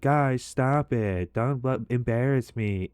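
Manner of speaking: imitating the drunk date scolding her teasing friends; embarrassed drunk girl. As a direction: drag out a tipsy "stop it" at her friends. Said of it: "And she's being like".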